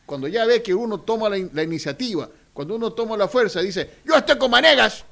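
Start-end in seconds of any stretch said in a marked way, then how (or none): 4.0-5.0 high, loud cheering yell; shouting supporter. Retold or imitated. Supporter joining the winning side, loud cheer. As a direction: cheer like a newly bold backer. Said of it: "y comienza a gritar".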